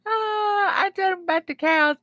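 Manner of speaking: high, crying with laughter; laughing while talking. Real, not acted. Streamer cracking up about sick cows, laughing through words. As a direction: talk through tears of laughter.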